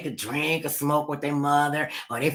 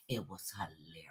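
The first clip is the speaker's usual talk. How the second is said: whispered aside; 8 semitones lower, 18 dB quieter than usual. Real, not acted.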